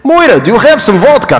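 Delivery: high, fast, hammed-up Russian nun; joking mock Russian accent, pitched well up. Speaker jokingly voices the nun with a Russian accent. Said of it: "I can try and do a Russian".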